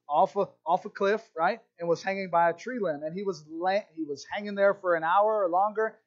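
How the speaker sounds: ordinary talk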